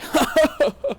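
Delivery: high, loud mocking laugh; a snooty villain's shrill laugh burst; preppy laugh. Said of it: "He then laughed in a preppy way".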